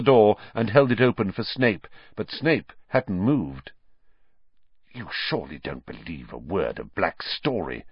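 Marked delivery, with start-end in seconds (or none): 4.9-7.8 low, soft, near-whisper. A narrator's reading. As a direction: murmur it low and intently. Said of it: "Snape whispered".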